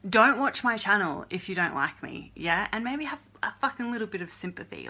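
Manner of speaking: loud, furious rant at the trolls; angry at trolls. Real, not acted. To the trolls watching the channel, a loud angry rant. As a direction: yell it at the trolls, fed up.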